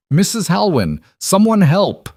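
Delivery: high, loud panicked yell; scream for help. A narrator's reading. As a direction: shout for help, voice raw. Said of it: "she screamed".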